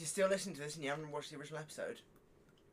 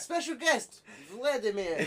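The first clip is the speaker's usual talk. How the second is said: hammed-up announcer intro, higher and louder, laughing; 6 semitones higher, 8 dB louder, much slower than usual. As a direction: announce the special guest like a host, half laughing. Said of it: "I can pretend to be, like, Vladimir".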